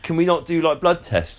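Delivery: high, disbelieving voice of a sceptical producer; incredulous TV exec. Retold or imitated. Mock TV exec questions Gillian.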